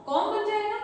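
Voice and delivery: ordinary talk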